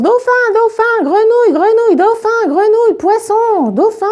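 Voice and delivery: put-on high-pitched kids shouting over each other; excited high-pitched chorus of shouts; children yelling. A narrator's reading. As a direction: cry out team names in a high child voice. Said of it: "se mettent à crier dans tous les sens".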